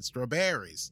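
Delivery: "strawberries" with a full, open "BEAR-eez" ending; strawberries, American way. Real, not acted. Repeating the laughed-at word, American straw-bear-eez. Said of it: "laughing at you, the way you said".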